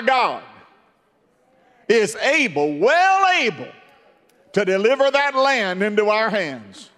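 rising, loud, declaring as Joshua; loud voice of a fearless Joshua; bold preacher shout. Retold or imitated. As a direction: boom out Joshua's defiant faith. Joshua voiced in sermon retelling.

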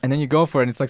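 ordinary delivery

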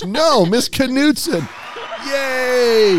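high, slow, joyful cheer; excited cheer. Acted. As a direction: celebrate big and high. Mock celebrating the teacher news.

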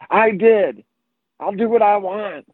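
high, insistent mock kid voice; defiant young child. Four-year-old talking back to his dad, imitated defiant child.

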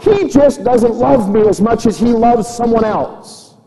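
loud, high preaching in a doubter's voice; impassioned preaching. Acted. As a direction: preach it as an indignant complaint at full force. Preaches, voicing the doubter's complaint.

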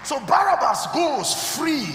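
loud rising preacher's cry to the church; preaching at full force. Acted. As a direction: drive it home to the congregation, shouting.

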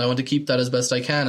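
ordinary talk, nothing marked

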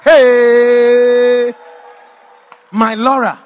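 long, loud wail of heartbreak; mock wail. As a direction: cry out dramatically, stretching it out. Wailing over lost love in dream.

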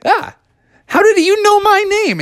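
high-pitched startled yelp and question; very high, astonished voice; startled Froggy. Acted. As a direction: react in alarm as a cartoon kid. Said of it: "And Froggy was like".

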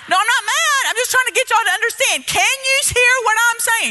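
high, loud mock yelling at kids who don't understand; retold screaming. Preacher reenacting yelling at Haitian orphan boys, loud and high. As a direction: belt it out high, insisting you're not mad. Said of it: "screaming at these boys".

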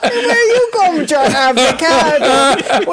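high, slow, laughing outcry; laughing disbelief. Retold or imitated. Daughters mock their dad's avocado offer, laughing and loud. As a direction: crack up at the absurd offer. Sisters slag dad over avocado.

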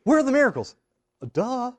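high-pitched mock whine, then duh; mocking disbelief. Preacher mimics Gideon questioning an angel, mocking disbelief. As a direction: parrot a foolish question.

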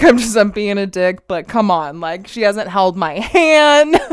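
loud imitation of an aggrieved husband; mock exasperated complaint. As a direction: plead his case loudly, playing it up.

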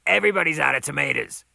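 loud, rapid reply; boss calling back. Acted. Boss replies to cook, loud quick voice. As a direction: fire it back fast and loud.

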